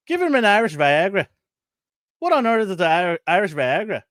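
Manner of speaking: a hint of Irish doctor and patient trading lines; Irish voices. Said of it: "Let me get my Irish accent out".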